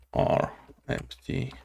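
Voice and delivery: usual speaking voice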